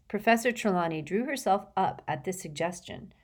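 usual speaking voice

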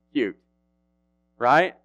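ordinary talk

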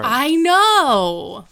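loud, drawn-out excited agreement; big high-pitched exclamation. Real, not acted. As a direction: shout it back, high and stretched. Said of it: "you got loud".

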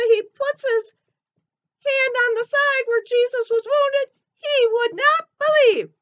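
high, squeaky cartoon elephant. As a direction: chirp it like a little elephant puppet. Ellie tells of Thomas.

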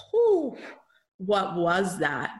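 blown away after the healing; stunned whew. Retold or imitated. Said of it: "and I came out like".

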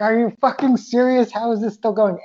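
high, incredulous outburst at himself; retelling his own baffled frustration at hours of crying; exasperated disbelief. Retold or imitated. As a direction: throw up your hands, pitched well up, can't believe it's still going. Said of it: "I'm like".

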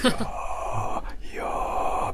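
voiceless whisper with lively expression; expressive whisper. Shown as a demonstration. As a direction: whisper it with feeling, not deadpan. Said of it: "Use expression".

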